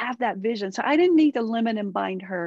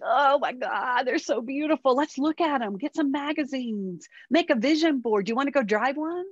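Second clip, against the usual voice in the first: delighted mom; 4 semitones higher than usual. Retold or imitated. Mom cheering on her daughter's car wish, higher and gushing. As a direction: sell the dream with big enthusiasm. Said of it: "It's like".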